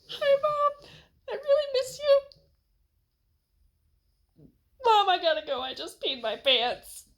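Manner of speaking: put-on very high, thin child's voice, slowing on the last line; squeaky kid voice. Kid on the phone to his tearful mom, squeaky high voice. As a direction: play the homesick kid blurting it out.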